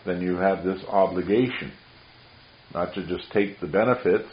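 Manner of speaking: ordinary talk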